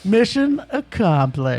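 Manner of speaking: drawn out, mission stressed; mock-grand slow declaration; slow proclamation. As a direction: savour each word slowly. Repeating catchphrase as a joke.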